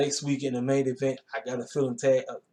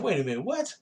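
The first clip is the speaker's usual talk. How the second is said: surprised disbelief; 6 semitones higher than usual. Retold or imitated. Reacting in disbelief to the promo.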